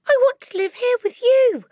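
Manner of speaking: high, loud pleading cry; a child begging with all her heart; cried out. A narrator's reading. Matilda cries out to Miss Honey, pleading loudly. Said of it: "Matilda cried out".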